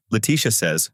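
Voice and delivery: ordinary delivery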